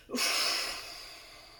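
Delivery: sharp loud puff of breath; forceful exhale. Shown as a demonstration. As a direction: blow the air out hard. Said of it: "Je souffle fort".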